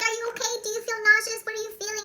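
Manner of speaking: high, urgent fussing; worried questioning. Retold or imitated. Relatives checking on the bitten girl, high anxious questions. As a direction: imitate alarmed relatives, pitched high.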